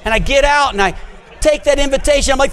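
ordinary talk, nothing marked

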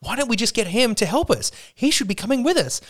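high, incredulous disbelief; baffled party members. Retold or imitated. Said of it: "The other characters are like".

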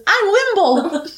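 put-on tiny gnome boy, high and squeaky; high squeaky child voice. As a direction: squeak it out like an excited little kid. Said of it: "really high up and says".

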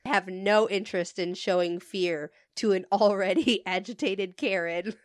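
ordinary talk, nothing marked

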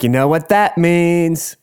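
high, slow, stretched mock-ominous question; drag hosts' teasing. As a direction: tease the loser as a drag host. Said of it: "they're like".

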